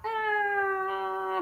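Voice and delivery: long, soft, high mock whine; exaggerated sustained squeal; drawn-out high "ah". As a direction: hold a high, quiet sound of doubt. Acted reply to mom's remark.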